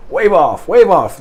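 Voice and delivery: loud, urgent call of wave off; shouted wave off. Retold or imitated. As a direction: call it out loud like a landing officer. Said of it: "would go".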